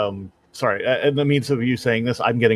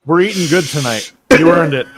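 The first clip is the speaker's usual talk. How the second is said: ordinary talk, nothing marked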